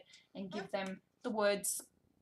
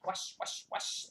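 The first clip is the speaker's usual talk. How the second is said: swishy whispered washing noise; scrubbing sounds; slower than usual. Pretend. Acting out washing the cat, brisk swishy whisper. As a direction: make the word swish like scrubbing.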